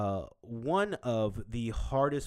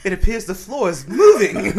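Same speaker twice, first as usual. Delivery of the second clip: loud, higher British put-on through laughter; mock British drunk; 9 semitones higher, 16 dB louder than usual. Host playing the drunk British mom, laughing. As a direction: play a tipsy, proper Brit.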